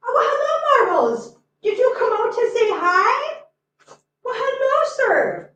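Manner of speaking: high, cooing greeting to a pet; pet talk. Real, not acted. Owner greets Marbles coming out to say hi, high pet talk. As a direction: greet the cat in a high, delighted coo.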